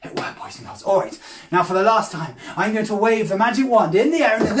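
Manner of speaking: ordinary talk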